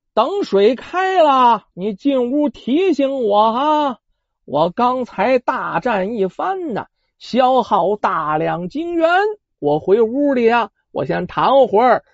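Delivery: slow, tired drawl of a worn-out fighter; weary. Acted. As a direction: let the exhaustion slow every word. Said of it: "面露疲惫之色呀". (大战之后疲惫、缓慢地说)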